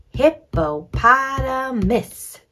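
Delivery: "hippopotamus" high, loud and slow; emphatic HIP-PO-POT-A-MUS; repeat-after-me syllables. Shown as a demonstration. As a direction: model "hippopotamus" beat by beat for repeating. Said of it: "repeat after me".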